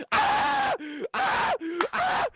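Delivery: three piercing high shrieks of pain; pain screams. Retold or imitated. Patient screaming as the doctor pushes the needle down. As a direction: scream like the needle just went in.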